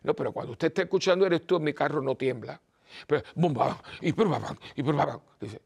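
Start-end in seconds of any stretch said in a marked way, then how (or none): 3.3-5.2 booming car-stereo bass noises; punchy mouth-made bass beats; imitated bass thump. Driver mimicking a blaring car stereo, imitated bass thump.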